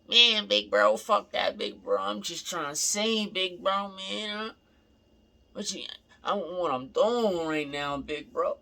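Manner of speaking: high, loud, whiny complaining voice. Retold or imitated. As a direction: whine it up high like a sulky kid brother. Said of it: "He's whining".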